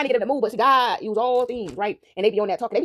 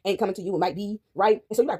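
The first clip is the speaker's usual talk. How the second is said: usual speaking voice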